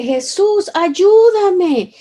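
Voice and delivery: retold cry for help. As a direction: call out to him from far away, pleading. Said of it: "Y yo le grité".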